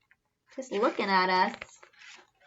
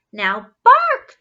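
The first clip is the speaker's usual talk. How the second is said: much higher, louder call; loud command; 11 semitones higher, 10 dB louder than usual. A narrator's reading. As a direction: insist the dog bark. Mother commands dog.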